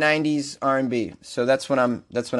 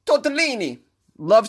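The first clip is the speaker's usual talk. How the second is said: high-pitched Italian flourish; proudly rolled-out comic Italian; sexy Italian accent; 7 semitones higher than usual. Shown as a demonstration. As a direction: show off the word in a hammy Italian accent. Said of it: "with this sexy-ass Italian accent".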